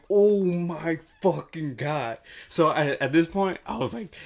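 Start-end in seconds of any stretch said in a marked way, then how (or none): 0.1-2.2 slow, drawn-out, louder shock; shocked disbelief. Retold or imitated. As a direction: stretch each word in stunned disbelief. Sees the photo emailed to him.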